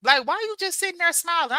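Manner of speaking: puzzled husband checking on her; imitating husband. Imitating a puzzled husband asking his wife. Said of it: "My husband be like".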